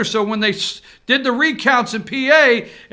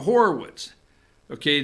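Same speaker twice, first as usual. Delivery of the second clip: usual speaking voice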